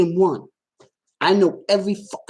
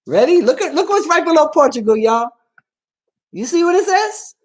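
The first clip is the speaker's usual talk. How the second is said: high, excited call to look; excited build-up; 9 semitones higher, 3 dB louder than usual. Real, not acted. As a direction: build suspense for the big reveal, pitched up. Building up the ancestry reveal.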